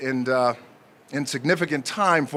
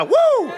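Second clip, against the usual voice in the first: 1 excited woo; 5 dB louder than usual. Real, not acted. Cheering himself at the podium.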